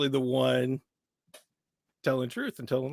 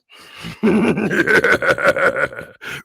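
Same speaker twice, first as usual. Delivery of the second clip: loud dark villain laugh; evil laugh; 3 semitones higher, 9 dB louder than usual. Shown as a demonstration. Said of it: "I always have to start with a laugh".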